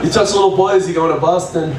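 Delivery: ordinary talk, nothing marked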